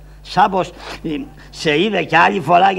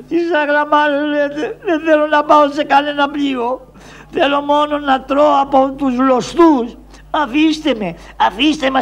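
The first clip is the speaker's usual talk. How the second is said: stubborn protest; 9 semitones higher than usual. Acted. Struggling against soldiers.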